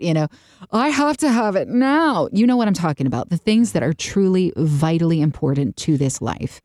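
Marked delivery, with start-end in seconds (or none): 0.7-2.3 demanding whine. Retold or imitated. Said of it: "not what I quote".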